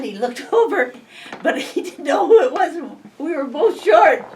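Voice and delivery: giggling as she recalls it; laughing. Real, not acted. Telling a friend about the banker, laughing.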